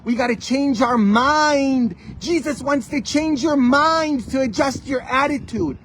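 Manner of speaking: high, loud, impassioned proclamation; full-force preaching. Real, not acted. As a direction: cry it out to the congregation.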